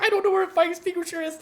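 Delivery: high, rushed panic, words tumbling out; panicked. Retold or imitated. Coworker asked for help while the truck burns, high panicked voice. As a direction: sound like you're losing it.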